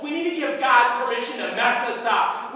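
usual speaking voice

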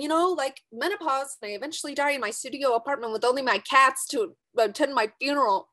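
ordinary talk

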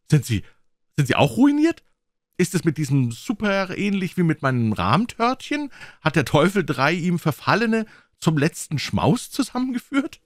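put-on high, loud, excited young man; crying out. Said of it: "schrie der junge Mann". (aufgeregt und hoch gerufen)

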